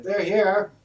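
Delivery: high excited call from inside; servant calling out. Acted. Servant announcing the party's arrival, high call. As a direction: shout the arrival to the house. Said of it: "you hear a servant on the inside".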